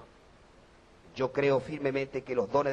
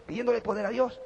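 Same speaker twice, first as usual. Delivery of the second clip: ordinary talk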